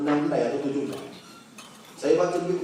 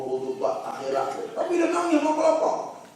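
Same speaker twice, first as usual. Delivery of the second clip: normal talking voice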